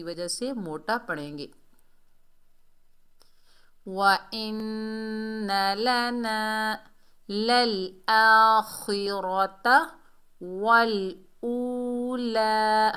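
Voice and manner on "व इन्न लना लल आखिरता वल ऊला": slow, drawn-out tajweed chant; Quran recitation. Shown as a demonstration. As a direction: recite it long and steady. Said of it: "वाव को लाम से मिलाएंगे".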